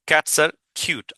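very slow, each word spaced; slow model sentence. Shown as a demonstration. Teacher reading an example sentence slowly. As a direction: read it out slowly for students. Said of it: "যেমন বিড়াল সুন্দর".

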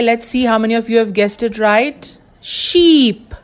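ordinary talk

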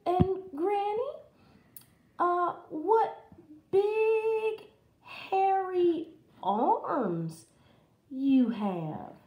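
put-on high, slow girl voice; hesitant high-pitched wonder; amazed Little Red. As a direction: marvel slowly, stretching the words. Little Red marvels at disguised wolf.

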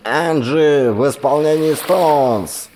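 high, slow radio DJ announcement crackling from a speaker; upbeat radio host intro; DJ over the radio. A narrator's reading. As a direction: announce it like a late-night DJ on a tinny radio. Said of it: "с потрескиванием объявил диджей из динамика".

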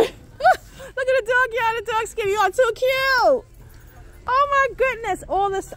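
high, laughing squeal; laughing delight. Real, not acted. Shopper laughing at the dog that scared her, high squeal. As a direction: giggle through the fright.

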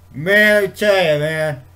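hammed-up shout of a man caught in the rain; loud pub guy. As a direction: call it out like a soaked guy bursting into a pub. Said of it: "I know it's an Irish accent, I'm not even gonna try".

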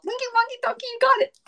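very high squeaky singsong; high-pitched playful nonsense rhyme; silly singsong. Acted. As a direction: chirp it way up high like a cartoon.

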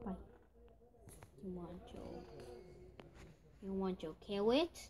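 ordinary talk, nothing marked

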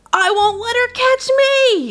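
put-on very high terrified little-kid cry; panicked shriek. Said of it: "he shrieked".

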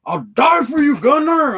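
high, loud earnest vow; quoting the vato. Retold or imitated. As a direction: belt the loyal vow way up high. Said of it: "That vato says".

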